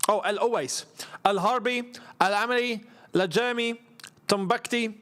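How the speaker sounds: usual speaking voice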